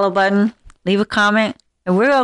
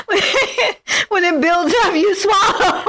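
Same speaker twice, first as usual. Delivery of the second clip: laughing; 10 semitones higher than usual. Real, not acted. Laughing while giving advice.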